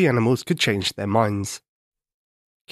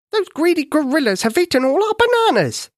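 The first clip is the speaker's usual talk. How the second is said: much higher, whiny monkey voice; a monkey complaining to the king about gorillas, high and whiny; moaning monkey; 4 dB louder than usual. Acted. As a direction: moan like a hard-done-by little monkey. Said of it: "a monkey moaned".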